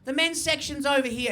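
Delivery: mildly put-on apologetic saleswoman; shop lady. Lady working in the shop redirects a male customer, lightly voiced. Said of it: "this lady rocks up".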